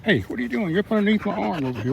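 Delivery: playful put-on pet voice; voicing the dog. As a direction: speak for the grumpy dog, fast and high. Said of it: "She gets so mad, really".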